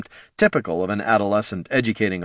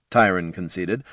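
ordinary delivery, nothing marked

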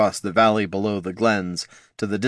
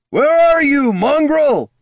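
much higher, loud furious bellow; 7 dB louder, slower than usual. Acted. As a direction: bellow down the alley in rage. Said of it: "bellowed a furious voice".

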